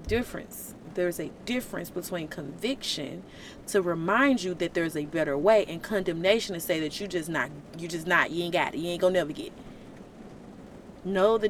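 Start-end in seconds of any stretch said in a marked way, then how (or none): none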